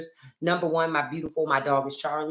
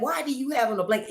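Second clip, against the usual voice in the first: much higher and fast, baffled at her husband; incredulous question; 10 semitones higher, faster than usual. Retold or imitated. Asking her freezing husband about his blanket, high and incredulous. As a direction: sound amazed he needs a blanket. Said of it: "I'm always like".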